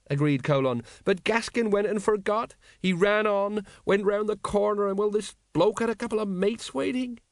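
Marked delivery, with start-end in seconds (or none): 1.0-7.2 high, plaintive retelling; miserable. A narrator's reading. Tipsy sergeant telling how Gaskin was ambushed, miserably. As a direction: tell it woefully, pitched well up. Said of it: "said Colon miserably".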